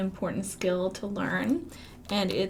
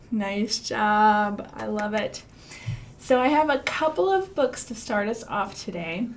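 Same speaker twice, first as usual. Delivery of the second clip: normal talking voice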